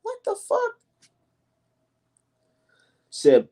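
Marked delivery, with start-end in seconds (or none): 0.0-0.8 much higher, squeaky what the fuck; mock disbelief. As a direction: act stunned at the early retweeters. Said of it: "And I'd be like".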